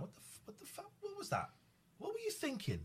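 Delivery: high, hushed incredulous demand at his abuser; half-voiced disbelief, thrown high; imagined confrontation. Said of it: "I often fantasize about going up to him and going".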